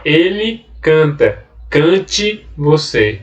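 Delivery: slow, drawn-out example read for the class; slow demonstration. Shown as a demonstration. Teacher reading an example to students, slow and deliberate. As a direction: spell out each word slowly. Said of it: "Olha só".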